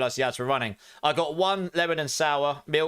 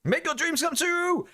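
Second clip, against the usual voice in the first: very high, slow screaming hype; motivational shout; slower than usual. Pretend. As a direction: yell it like a hyped motivator. Shouted motivational meme quote.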